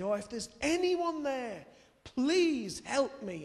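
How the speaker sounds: high, loud, slow cry for help; desperate plea. Retold or imitated. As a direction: cry out to anyone listening, desperate. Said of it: "cried out to anyone who might be there".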